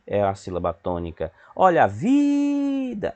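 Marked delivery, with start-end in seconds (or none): 1.6-3.2 high, long drawn-out VI in "vida"; street vendor call. Shown as a demonstration. Teacher showing pupils the stressed syllable, stretched vendor cry. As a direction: sing it out like a hawker. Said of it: "faz o vendedor".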